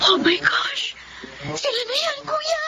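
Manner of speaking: hushed gasp rising to a high squeal; breathless excitement. Acted. Sister sees long-lost relatives approaching, breathless excitement. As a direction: whisper it, then let the pitch leap.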